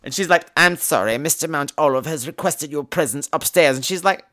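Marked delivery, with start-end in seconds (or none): 0.5-3.8 lower, softer, formal servant voice; formal nurse. Retold or imitated. Nurse summoning Maggie upstairs, formal. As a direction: deliver a polite, formal summons.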